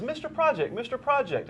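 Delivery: put-on high, piping little boy voice; little kid voice. Host mimics a kid on the street, high little boy voice. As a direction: squeak it like a small child tugging a shirt. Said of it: "he tugs on my shirt and he says".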